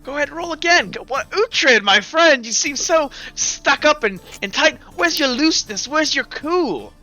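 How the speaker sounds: cocky dice hustler egging someone on; goading gambler. Acted. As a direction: needle the player in a high, teasing voice. GM voices gambler goading player.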